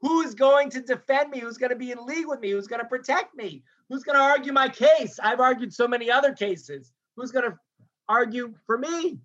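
retold helpless appeal, pitched high; helpless pleading. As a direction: plead for a defender, acted. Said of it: "This helpless sense".

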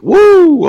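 very high, held "woo"; excited whoop. Pretend. Host cheers his imagined match, high excited whoop. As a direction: let out a high mock crowd whoop.

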